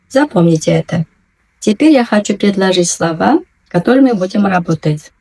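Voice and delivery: ordinary talk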